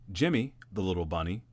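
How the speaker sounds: usual speaking voice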